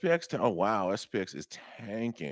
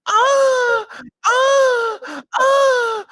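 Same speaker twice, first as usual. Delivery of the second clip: piercing high squawks, full volume; bird distress cry; 16 dB louder, much slower than usual. Acted. Host's bird-in-distress impression, shrill loud squawks. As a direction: shriek like a freezing bird. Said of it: "Go, "That's very cold".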